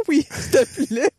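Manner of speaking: high, slow, sheepish mock plea; sheepish please. Accepting the officer's notepad, meek and drawn out. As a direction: beg it meekly, drawn out. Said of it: "Je dis".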